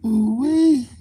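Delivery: high, loud delighted ooh-wee; excited ooh-wee. Real, not acted. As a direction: squeal it out in delight. Reaction exclamation, then repeat.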